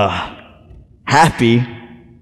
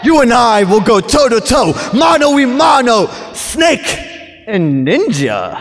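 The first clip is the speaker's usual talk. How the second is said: building hype promo; 4 semitones higher than usual. Acted. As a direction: build to a big showdown call. Wrestler builds his promo.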